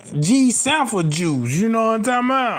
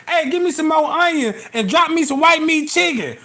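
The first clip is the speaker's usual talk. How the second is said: raised voice shouting to the kitchen; calling out order; 7 semitones higher than usual. Retold or imitated. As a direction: call it over the counter.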